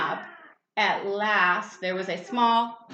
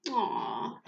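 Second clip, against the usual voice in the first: low soft cooing aww; tender aww; 6 semitones lower, 6 dB quieter than usual. Real, not acted. Parent cooing over the bunny kissing the cat, soft aww. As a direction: coo fondly at the cute moment. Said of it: "cooing at story".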